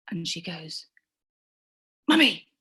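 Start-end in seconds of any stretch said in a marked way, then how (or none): 2.1-2.5 high, loud cry of "Mummy"; sudden high startled call; alarmed call. Retold or imitated. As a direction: call out for your mother in a panic. Daughter calling out at night.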